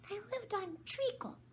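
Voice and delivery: very high squeaky mouse; Dormouse voice. Acted.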